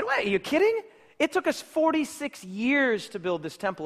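high, disbelieving outburst; incredulous. Acted. Temple crowd scoffing at Jesus' claim, high and incredulous.

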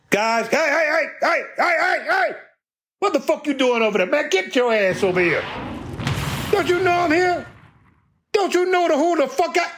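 high, far-off bossy manager yelling at the crew; mean manager. Retold or imitated. Mean district manager hollering at the crew to get over here. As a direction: bark like a mean boss calling the guys over.

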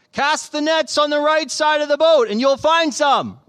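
loud, high shouted instruction; calling out. A narrator's reading. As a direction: call out to a boat offshore. Jesus calls instructions to boat.

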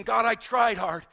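loud self-justifying plea; mock plea. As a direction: insist on your own effort.

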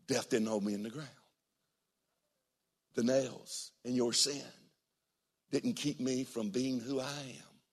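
quietly voiced Jesus, hushed; hushed, reassuring Jesus; soft voice of Jesus. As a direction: speak as a gentle saviour.

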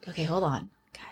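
hushed whisper while hiding. Real, not acted. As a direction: whisper so the killer won't hear. Hiding from the killer.

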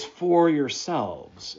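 "for yourselves" slow and deliberate, leaning on for; slow, pointed "for yourselves"; emphatic. Shown as a demonstration. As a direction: draw out "for yourselves" to underline it. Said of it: "It's emphatic".

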